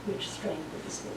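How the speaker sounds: whispered ending of the verse; hushed scripture close. Acted. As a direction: breathe the last words out.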